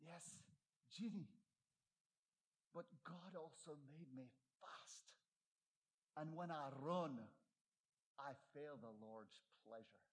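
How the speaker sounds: a light hint of a Scottish brogue. Eric Liddell answering his sister, lightly Scottish. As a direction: gently slip into a faint Scottish lilt. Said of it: "in his wonderful Scottish brogue".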